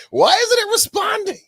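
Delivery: high mock shriek of outrage; hammed-up angry yell, very high; mad, imitated. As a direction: wail it like someone furious at a gadget. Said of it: "she was so mad".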